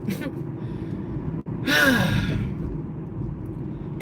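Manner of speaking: long, weary outbreath; exasperated sigh. Real, not acted. Fed up with online harassers, long exasperated sigh.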